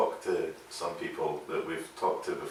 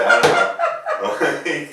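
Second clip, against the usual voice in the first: laughing; 8 semitones higher, 10 dB louder, much slower than usual. Retold or imitated. Imitating drunk bandmate.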